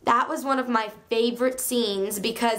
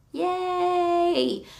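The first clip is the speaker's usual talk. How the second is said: cheer; 7 semitones higher than usual. Real, not acted. Celebrating her correct guess.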